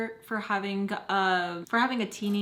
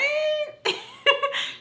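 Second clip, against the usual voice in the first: high laugh. Real, not acted. Laugh after mom's insult.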